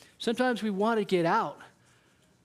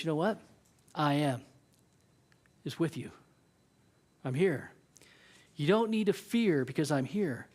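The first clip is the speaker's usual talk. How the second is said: gentle comfort; 4 semitones lower than usual. Acted. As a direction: hush a scared child. Jesus comforting in the storm.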